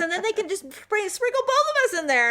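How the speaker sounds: squeaky imitation of him brushing it off; husband voice. Wife imitating husband about the ashes, high mocking voice. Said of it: "He goes".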